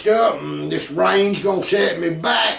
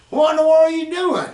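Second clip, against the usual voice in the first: surprised; 8 semitones higher, faster than usual. Retold or imitated. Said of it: "He said".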